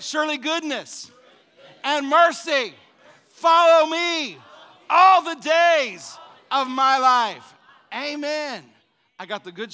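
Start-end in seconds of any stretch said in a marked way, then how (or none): 0.0-7.5 loud, slow, laughing shout of the verse; rising to a joyful roar; shouted scripture. Real, not acted. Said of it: "come on, say it". 7.9-8.6 loud, high, driving sermon voice; full-force pulpit preaching; fiery preaching. Acted.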